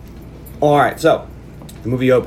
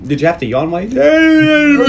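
playful teasing; 13 semitones higher, 7 dB louder than usual. Real, not acted. Teasing a cohost about yawning.

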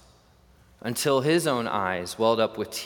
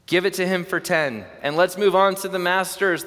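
higher and louder call from the crowd; impatient call; 7 semitones higher, 4 dB louder than usual. Acted. Crowd member calling to the auctioneer, high and loud. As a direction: hurry the auctioneer along.

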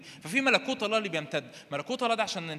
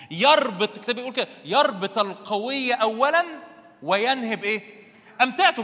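loud, raised preaching voice; forceful preaching; 4 semitones higher, 7 dB louder than usual. Real, not acted. Preacher teaches congregation about binding the strong man, forceful. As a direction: pound out the key words at full force.

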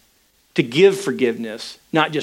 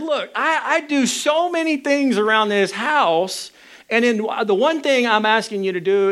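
much higher, aggrieved protest; a husband hurt and pleading his case; indignant complaint; 9 semitones higher than usual. Retold or imitated. Husband protesting to his wife about all his chores, higher and aggrieved.

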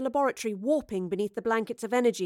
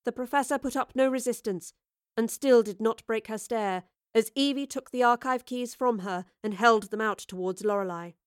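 usual speaking voice

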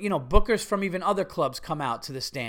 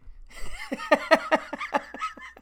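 long high wheezing laugh; real laughter; 13 semitones higher than usual. Real, not acted. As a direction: crack up at your own misfortune. Laughs at his own story.

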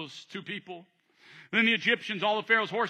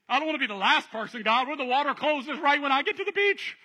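panicked fear; 6 semitones higher than usual. Acted. Scared believer crying out to God, panicked. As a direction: fret that the walls will collapse. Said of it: "the other person having another experience".

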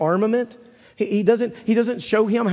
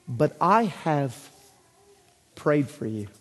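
a hint of tender Jesus; 3 semitones lower, slower than usual. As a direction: lean in gently, lower. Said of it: "he says".

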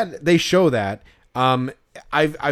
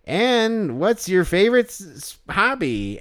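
slow, stilted interviewer, pitched up; canned hot dog host; 6 semitones higher, slower than usual. Acted. Hot dog character interviews a little kid, stilted and drawn out. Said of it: "that's a good impression that you're doing".

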